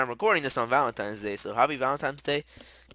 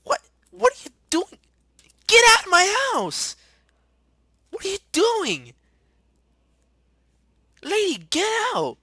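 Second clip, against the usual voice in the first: piercingly high, very loud screech; outraged high-pitched shouting; indignant screaming; 11 dB louder than usual. Real, not acted. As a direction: scream at an intruder to leave. Yelling at intruder in game house.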